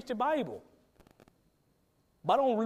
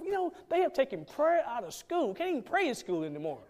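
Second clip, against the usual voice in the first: higher, worked-up grumbling about school prayer; higher mock lament, slowing at the end; complaining churchgoer; 5 semitones higher than usual. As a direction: act a frustrated believer lamenting the country. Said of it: "Christians, say".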